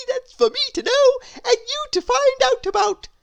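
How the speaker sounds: high, loud teasing worm voice; laughing worm. Acted. The worm teasing Miss Curious, laughing. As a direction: tease her with a giggle in the voice. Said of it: "said the worm, laughing".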